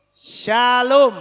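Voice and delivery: Shalom loud, with a clear sh; correct pronunciation. Shown as a demonstration. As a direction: say "Shalom" as SHA-lom, falling. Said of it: "Shin adanya jadi sha". (Shalom diucapkan dengan bunyi sy yang jelas)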